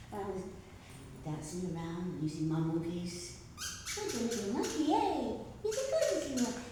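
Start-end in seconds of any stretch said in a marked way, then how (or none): 3.6-6.6 high-pitched playful cooing over a toy; puppet-play voice. Acted. Entertaining people with toy monkeys, playful high voice.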